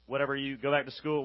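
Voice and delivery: ordinary talk, nothing marked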